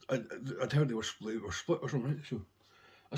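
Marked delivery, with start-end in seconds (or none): none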